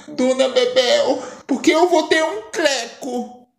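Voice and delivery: put-on high, alarmed girlish voice. Daughter alarmed at her mother, in a high frightened voice. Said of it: "fica assustada".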